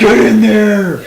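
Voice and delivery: drawn-out rallying yell; shout. Acted. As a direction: urge them in with a bellow. Shouted urging.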